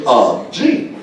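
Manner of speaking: a hint of Shatner calling him again; imitating Shatner. Shatner calling the neighbor a second time, lightly imitated. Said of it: "he goes again".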